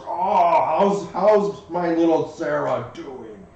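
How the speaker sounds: loud, slow, doting welcome; doting greeting. Acted. Rocco greeting little Sarah, loud doting welcome. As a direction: gush over a small child.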